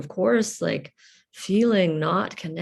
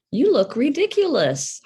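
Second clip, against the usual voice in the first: high, loud mock derision; imitated scornful parent; 8 semitones higher, 6 dB louder than usual. A father to his child, imitated scorn.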